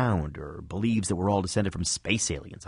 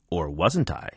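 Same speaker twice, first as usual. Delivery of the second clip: spookier voice; 4 semitones lower, slower than usual. Pretend. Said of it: "say in a spookier voice".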